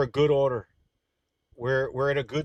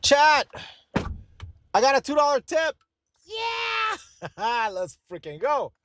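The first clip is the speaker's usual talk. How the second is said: cheering, much higher and louder; excited; 4 dB louder, slower than usual. Real, not acted. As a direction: shout it out like a big win. Celebrating a two dollar tip.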